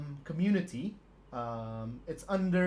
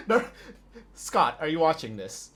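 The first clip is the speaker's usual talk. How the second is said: normal talking voice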